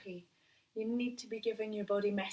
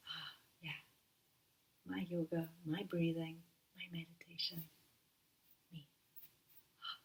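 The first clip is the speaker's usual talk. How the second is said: soft contented sigh; 3 semitones lower, 7 dB quieter than usual. Pretend. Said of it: "that feeling of coming back to".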